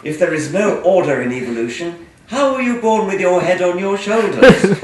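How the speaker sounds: loud, mock-indignant reading of hate mail aloud. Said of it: "read some of your hate mail".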